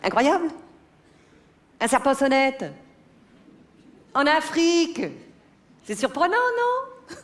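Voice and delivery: high, pointed disbelief rising to a squeak; mock amazement. Wife needling her husband about the snake, mock-amazed.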